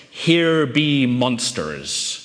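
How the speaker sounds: very slow, ominous storybook warning; spooky storybook voice. Acted. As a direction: draw it out like a monster warning on a children's adventure map. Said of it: "they'd say it in a voice just like that".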